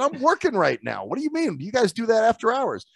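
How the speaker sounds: high-pitched, incredulous questioning; mock put-upon complaint; indignant protest. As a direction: re-enact objecting to an intruder, voice jumping up. Repeats protest to the cleaner.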